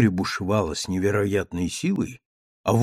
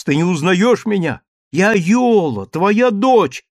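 desperate cry; 7 semitones higher, 5 dB louder than usual. A narrator's reading. Daughter pleads to be recognised.

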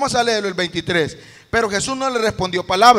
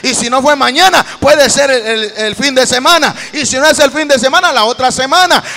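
loud, driving sermon climax; fervent preacher urging the congregation on; full-force preaching; 3 semitones higher, 10 dB louder than usual. Real, not acted.

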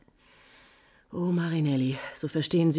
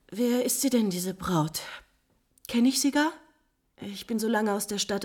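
ordinary talk

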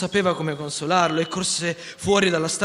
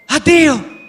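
much higher, loud shouted farewell; shouting goodbye; 13 semitones higher, 12 dB louder than usual. Acted. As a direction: fling a goodbye as you fly off. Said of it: "gridò Peter a Wendy".

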